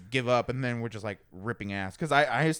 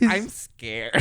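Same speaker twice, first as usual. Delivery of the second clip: put-on little girl voice; 3 semitones lower, 5 dB louder than usual. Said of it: "that line always throws me off".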